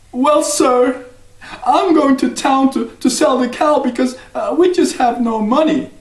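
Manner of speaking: put-on high, polite young boy. Jack politely answers the stranger in a high boy's voice.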